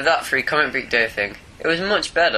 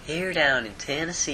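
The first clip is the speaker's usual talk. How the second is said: softer mock Southern US accent; a quieter stab at Tennessee twang; 5 dB quieter than usual. Co-host repeats the line for his friend, soft Southern accent. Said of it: "That's close enough".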